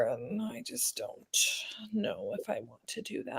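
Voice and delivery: whispered and trailing off. Real, not acted. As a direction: let it fade to a whisper. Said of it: "trails off unsure".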